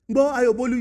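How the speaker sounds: ordinary delivery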